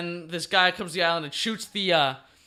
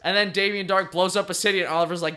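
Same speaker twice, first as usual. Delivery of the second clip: ordinary talk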